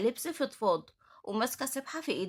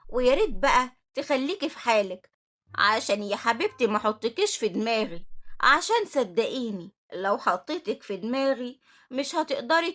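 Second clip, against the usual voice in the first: loud, threatening warning; menacing supervisor laying down the law; angry; 6 dB louder than usual. Acted. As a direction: warn her off with loud anger. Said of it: "ردت عليها بكل غضب".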